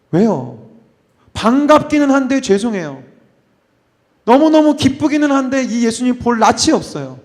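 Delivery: 높고 큰 목소리로 애타는 베드로; 죄송하고 반가운 마음을 터뜨리듯. Acted. (베드로의 애타는 목소리)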